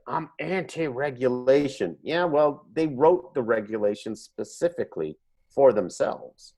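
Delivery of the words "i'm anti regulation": lightly put-on smug voice; mock voice. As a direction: parrot a smug slogan. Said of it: "if you're like".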